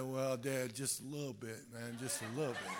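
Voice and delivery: quiet, sheepish mumble; sheepish confession. Retold or imitated. Teen son admitting to father, sheepish quiet mumble. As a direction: shrink and admit it small.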